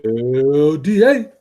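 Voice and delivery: long, loud drawn-out call. Real, not acted. Co-host hollers to the other host, calling out. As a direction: stretch the name out in a loud holler. Calls out co-host's name, drawn out.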